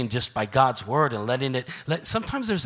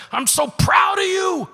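much higher, louder, slow cry of pride; proud exclamation; 7 dB louder, slower than usual. Retold or imitated. Pointing up to God after a testimony, proud shout. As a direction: call it up to God with joy. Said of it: "I put my finger up towards God and I said".

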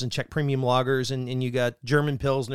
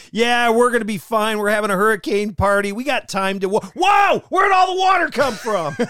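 high, loud mock party guy; careless partygoer shouting; 6 semitones higher, 5 dB louder than usual. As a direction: yell it like a reckless partier, then panic. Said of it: "Some people would be like".

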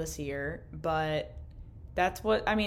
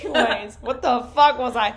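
laughing; 5 semitones higher, 11 dB louder than usual. Real, not acted. Recovering from laughing fit.

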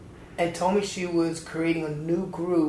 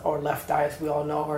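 ordinary talk

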